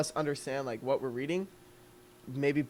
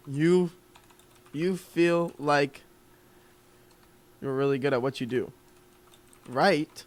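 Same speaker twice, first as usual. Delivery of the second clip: slow hook read; slower than usual. Shown as a demonstration. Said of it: "I would make it an open-ended question".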